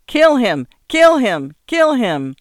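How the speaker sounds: high, loud repeated cry; loud cheering-on yell, each cry falling; shouted. A narrator's reading. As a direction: shout it like egging on a fight. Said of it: "ambiguously shouted".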